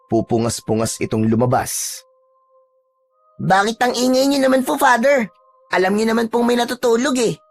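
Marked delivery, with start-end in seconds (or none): 3.4-7.4 put-on high-pitched childlike grumble; sleepy kid's high protest; complaining young boy. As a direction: whine about being woken up. Woken boy complains about noise.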